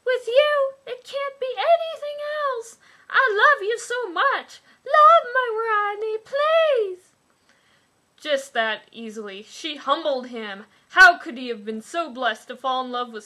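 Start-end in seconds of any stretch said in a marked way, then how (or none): 0.1-7.1 very high, loud mock-swooning heroine; goofy tone. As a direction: squeal it like a lovestruck heroine. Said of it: "worth reading in a goofy tone".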